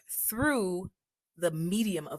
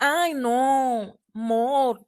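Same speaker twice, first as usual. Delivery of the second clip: "I know, more" in a lightly imitated Paisa lilt, amor said as "more" with the "a" dropped; playful Paisa-style "more" for amor, the first syllable swallowed; Paisa accent; 5 semitones higher, 6 dB louder, much slower than usual. Imitating Paisa speakers for her viewers, a playful Paisa lilt. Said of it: "I'm not trying to make fun of nobody".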